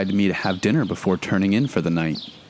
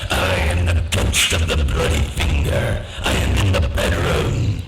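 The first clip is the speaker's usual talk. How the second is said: ghostly moan; 4 semitones lower than usual. A narrator's reading. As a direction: boom it out like a ghost in the room. Ghost enters bedroom.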